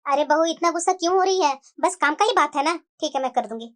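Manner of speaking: put-on a weary old lady sighing at a quarrel.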